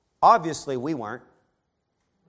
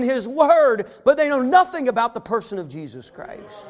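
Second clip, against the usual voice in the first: full-force preaching; 10 semitones higher, 7 dB louder than usual. Acted. Preacher to congregation about the Pharisees, fervent preaching. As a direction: drive the point home from the pulpit.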